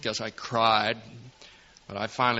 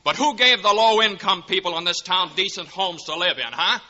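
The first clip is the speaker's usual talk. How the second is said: indignant defiance; 6 semitones higher than usual. Acted. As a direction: stand up to the banker in anger. George confronts Potter.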